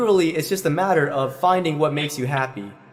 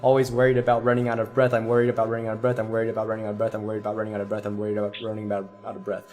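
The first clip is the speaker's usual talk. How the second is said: worried student imitation; 4 semitones lower, faster than usual. Teacher imitating student's constant worrying, fast descending rattle. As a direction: reel off worries faster, fading down to a low murmur. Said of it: "you are always talking about".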